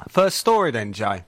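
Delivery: ordinary talk, nothing marked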